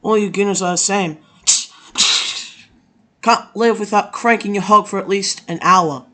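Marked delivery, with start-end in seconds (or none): none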